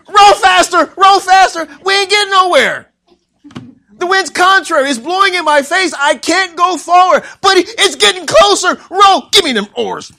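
high, loud panicked yelling; crying out in fear. Acted. Peter yelling at the rowers to flee, panicked shout. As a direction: scream frantic orders at a crewmate. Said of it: "they cried out for fear".

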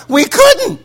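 high-pitched mock protest; acted, high-voiced excuse; helpless protest. Officers' helpless answer, acted.

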